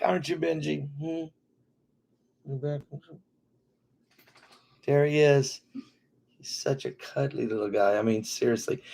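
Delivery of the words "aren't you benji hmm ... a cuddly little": tender pet talk; cooing to the puppy. Real, not acted. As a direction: coo gently at the little dog.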